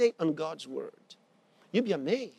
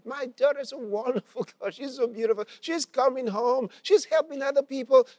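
high-pitched happy gushing; retold mother overjoyed about her daughter; happy, excited; 6 semitones higher, 4 dB louder than usual. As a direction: gush proudly, building higher and louder. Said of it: "started saying all these things so happily".

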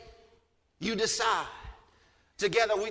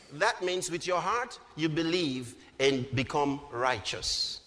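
ordinary delivery

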